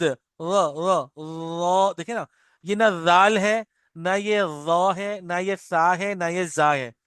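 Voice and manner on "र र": usual speaking voice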